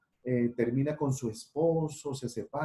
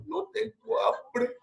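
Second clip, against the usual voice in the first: much higher, slow whiny moan; sulky refusal; much slower than usual.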